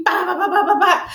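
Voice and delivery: rapid high "ba-ba-ba" gun noise; imitated gunfire. Tommy coming through shooting, mimicked gunfire. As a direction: rattle off mimicked machine-gun shots.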